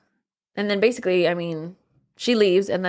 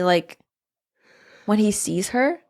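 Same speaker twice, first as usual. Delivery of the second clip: ordinary delivery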